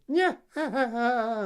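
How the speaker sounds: high cackling hag laugh; witch cackle. Acted. Witch cackles as she leaves.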